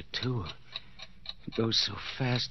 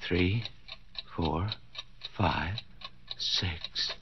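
softer, lower counting; hushed counting; 6 semitones lower, 8 dB quieter, much slower than usual. Acted. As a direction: count it under your breath. Counting seconds again.

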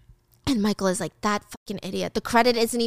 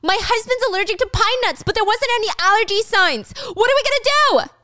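high, frantic wife voice; rising higher in panic; panicked wife; 11 semitones higher, 4 dB louder than usual. Retold or imitated.

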